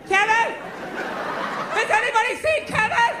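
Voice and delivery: high-pitched frantic mother calling out; acted panic, voice rising into a drawn-out question; mock freaking out. Mother can't find her kid in the park, mock panicked. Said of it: "Sitting there freaking out".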